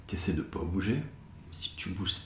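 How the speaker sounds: hushed whisper. Acted. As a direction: whisper it so they stay still. Meditation instruction, eyes closed.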